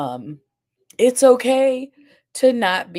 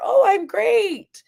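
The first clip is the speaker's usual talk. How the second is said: slow, much higher mock-cheerful "Oh, I'm great"; perky high voice; 5 dB louder, slower than usual. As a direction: drag out the fake sunny answer. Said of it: "their voice goes up".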